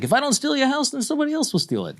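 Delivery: mock the settler in a squeaky, sped-up voice; brash Brooklyn settler. Host mocking a Brooklyn settler berating a Palestinian woman, squeaky fast mockery. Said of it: "And he's like".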